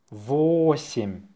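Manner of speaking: slow, stretched-out dictation. Shown as a demonstration. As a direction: draw the word out for learners. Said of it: "say it slowly".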